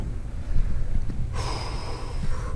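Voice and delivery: long unvoiced exasperated sigh; frustrated sigh. Real, not acted. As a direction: let out a tired sigh. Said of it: "God damn".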